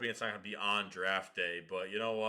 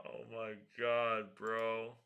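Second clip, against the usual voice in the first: normal talking voice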